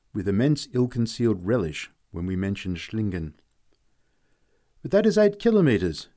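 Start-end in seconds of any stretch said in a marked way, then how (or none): 4.8-6.1 put-on high, loud protest from an old man; shouted. A narrator's reading. Old man at the fence shouting at the walkers. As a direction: shout the distance at them. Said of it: "shouted one old man".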